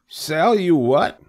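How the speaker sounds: high, loud, drawn-out question; baffled little pig squeal; puzzled cry. A narrator's reading. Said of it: "he cried".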